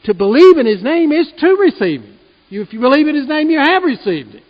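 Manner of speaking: fired-up pulpit climax; full-force preaching. Acted. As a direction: preach it hard to the congregation. Preaching climax, loud and high.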